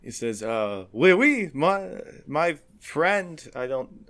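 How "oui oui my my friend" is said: high, halting fake French accent. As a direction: answer in a drawn-out mock-French accent. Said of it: "In a really fake French accent".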